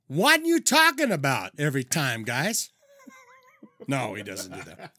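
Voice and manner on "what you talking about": catchphrase impression. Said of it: "goes by the name".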